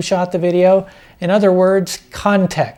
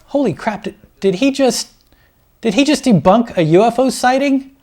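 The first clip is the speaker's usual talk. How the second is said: high, astonished disbelief; mock amazement; 5 semitones higher than usual. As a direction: gasp in fake shock.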